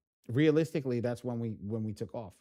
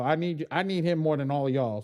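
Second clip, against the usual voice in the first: ordinary talk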